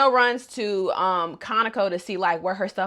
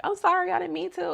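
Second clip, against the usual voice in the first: timid apology; 11 semitones higher, faster than usual. Retold or imitated. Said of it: "she's like".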